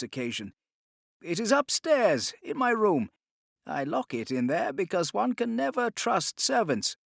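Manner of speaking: a touch of a smug rich man; voiced stall owner. A narrator's reading. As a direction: gloat over your coming wealth. New stall owner speaking.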